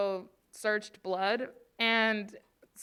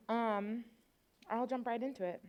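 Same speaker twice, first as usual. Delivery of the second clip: ordinary talk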